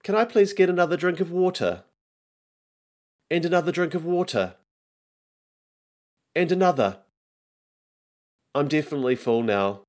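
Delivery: put-on high-pitched kid suspicious of dinner; fussy child. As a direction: complain high like a reluctant child. Lists kids' excuses at dinner.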